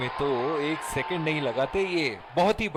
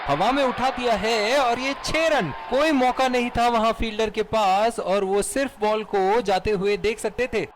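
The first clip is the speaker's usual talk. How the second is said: high, loud shout for a six; excited cricket commentary; 4 semitones higher, 4 dB louder than usual. Acted. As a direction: call the six at full cry. Calling a six live.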